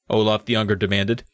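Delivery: usual speaking voice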